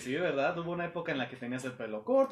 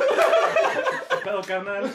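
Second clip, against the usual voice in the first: mock buddy greeting; 6 dB louder, much slower than usual. Joking greeting, stretched.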